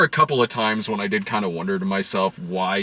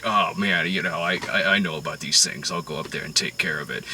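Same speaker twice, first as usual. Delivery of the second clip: low, softer creepy gangster; a lightly voiced sinister offer; creepy Whitey; 6 semitones lower, 6 dB quieter than usual. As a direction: mob boss smoothly insisting on going upstairs. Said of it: "He's like".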